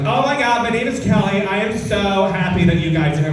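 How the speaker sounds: over-enthusiastic American server. Retold or imitated. American server greets customers as if on drugs, mock over-enthusiastic. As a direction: gush like an overjoyed Californian waitress. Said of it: "the enthusiasm".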